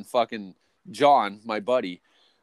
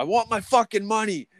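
high strained demand; retold demand; 11 semitones higher than usual. Worker demanding his pay at the boss's house, high. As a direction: bark for your money.

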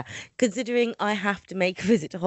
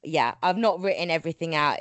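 ordinary talk, nothing marked

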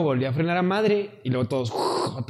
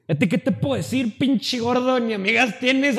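higher, taunting jab at his friend; mocking insult; 7 semitones higher than usual. Taunting his chubby friend in the car, mocking. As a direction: needle him like a bully. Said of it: "acá pasado de verga".

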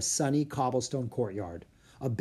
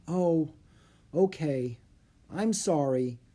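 ordinary delivery

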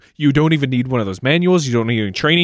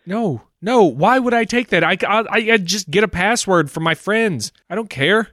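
exasperated; 9 semitones higher, 3 dB louder than usual. Acted. Exasperated caller rejects upsell.

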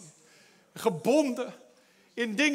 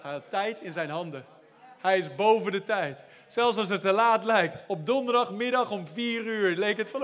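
ordinary talk, nothing marked

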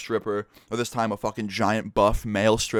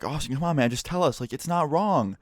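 higher mock coaxing friend voice; higher, coaxing mimicry of teasing friends; 6 semitones higher than usual. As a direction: imitate friends egging him on, voice up. Friends teasingly urging him out.

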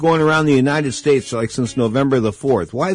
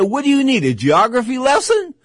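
sarcastic comeback; 9 semitones higher than usual. Retold or imitated. Retelling snapping back at customs lady.

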